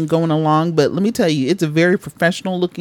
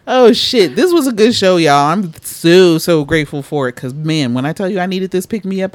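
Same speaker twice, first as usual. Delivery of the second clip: normal talking voice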